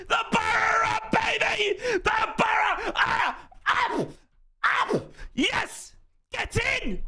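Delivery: high, screamed goal celebration; ecstatic cheering. Real, not acted. Fan cheering the Borough's goal, screamed ecstatic celebration. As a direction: whoop each phrase out in real jubilation.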